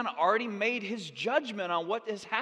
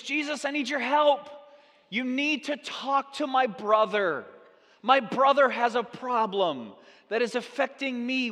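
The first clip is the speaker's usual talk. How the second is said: loud, raised-pitch acted appeal; pleading from crowd; 4 semitones higher, 6 dB louder than usual. Man from the crowd asking Jesus for help, insistent appeal. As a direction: project an insistent plea over a crowd.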